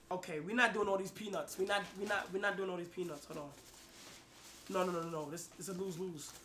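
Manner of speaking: muttering. Real, not acted. Dismay at game, quiet muttering.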